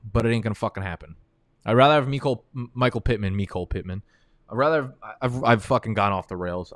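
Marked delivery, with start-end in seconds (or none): none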